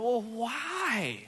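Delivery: high, hammed-up coaxing; mock pleading. Pastor retelling how he coaxed his daughter, high mock pleading. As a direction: plead with a stubborn little kid. Exasperated question.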